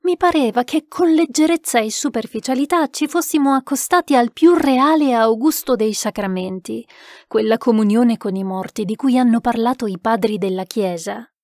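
ordinary delivery, nothing marked

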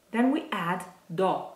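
ordinary talk, nothing marked

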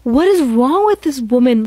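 slow, high, exasperated groan of disbelief. Real, not acted. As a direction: stretch it out in disbelief. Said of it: "reacting to the post".

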